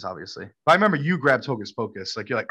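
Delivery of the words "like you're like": ordinary talk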